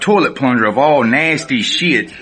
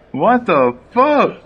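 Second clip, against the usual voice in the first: higher, drawn-out "what the fuck"; slow stunned disbelief; 8 semitones higher, much slower than usual. Retold or imitated. To the cellmate, slow stunned disbelief. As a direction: let the shock stretch it out. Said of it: "I'm like".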